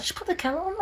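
high, soft mock parent; lightly voiced parent soothing everyone; parents' calm advice. Parents calming the household, high and soft. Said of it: "they'd be like".

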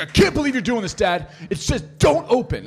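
high, loud imitation of an outraged kid; super mad son. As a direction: act out a teenager scolding Dad. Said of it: "he's super mad at me".

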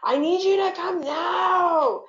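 screeching plea for pickup; high-pitched screech. Retold or imitated. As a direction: cry out like a drunk little brother. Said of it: "high-pitched voice screeched through the phone".

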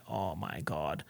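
very soft, low retold gasp of words; hushed awe. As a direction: shrink it to a tiny, low aside. Retells own overwhelmed reaction.

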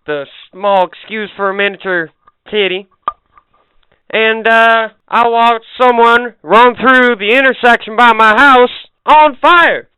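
high, loud Southern storyteller voice; southern accent. Pretend. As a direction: tell it like a Southern good ol' boy. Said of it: "got me my southern accent".

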